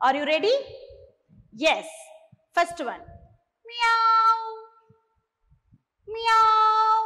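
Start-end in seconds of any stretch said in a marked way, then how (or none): 3.7-7.0 high meowing cat; playful cat sound. Shown as a demonstration. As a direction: mew like a cat for the kids. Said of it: "I will make a sound of an animal".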